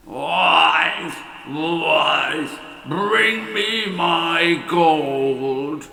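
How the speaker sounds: lightly voiced booming giant; giant's roar. A narrator's reading. Giant bellowing at his wife for gold. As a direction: call for the gold like a greedy giant. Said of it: "he roared".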